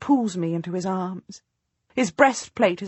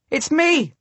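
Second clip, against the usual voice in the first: high joyful shout; husband yells; 8 semitones higher than usual. A narrator's reading. Husband calling to his wife from among the knights, glad yell. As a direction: call out over the army, overjoyed. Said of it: "my husband yells".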